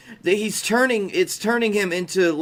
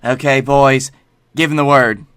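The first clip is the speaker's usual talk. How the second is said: egging on; 3 semitones lower, 8 dB louder than usual. Real, not acted. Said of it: "host urging co-host on cue".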